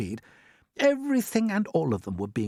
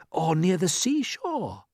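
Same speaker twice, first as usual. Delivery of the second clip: eagerly; 6 semitones higher, slower than usual. Acted. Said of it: "said James eagerly".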